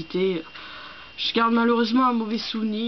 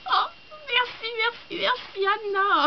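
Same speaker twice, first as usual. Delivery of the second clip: high, squealed gratitude; real squeal of thanks; delighted thanks; 11 semitones higher than usual. Real, not acted. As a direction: gush it high, overjoyed at the gift. Thanking friend for a gift.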